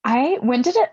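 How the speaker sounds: ordinary delivery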